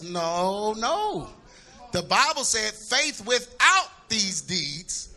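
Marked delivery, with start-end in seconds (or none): none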